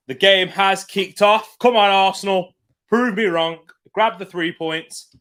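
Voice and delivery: rally the team as the game starts; excited cheering. Real, not acted. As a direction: fan cheering his team on kick-off.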